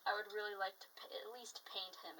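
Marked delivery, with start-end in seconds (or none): 1.1-2.2 whisper. Real, not acted.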